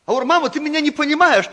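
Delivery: ordinary delivery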